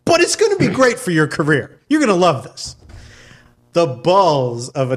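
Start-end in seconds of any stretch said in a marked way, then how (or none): 0.0-2.7 higher, upbeat director sweet-talk; Tom Six. Acted. Director selling it as a career move, eager pitch. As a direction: reassure the actor with salesman cheer. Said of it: "now imagine Tom Six, that's the director".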